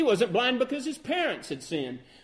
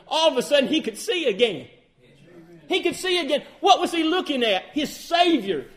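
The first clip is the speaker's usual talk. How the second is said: raised, ringing pulpit voice; full-force preaching; 3 semitones higher, 4 dB louder than usual. Acted. Pastor preaching Samson's rebirth to the congregation, full force.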